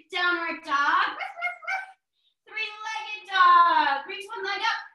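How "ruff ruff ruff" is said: high, softer yappy barks; playful dog barks. Pretend. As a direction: yip like a small puppy. Downward dog, barking again.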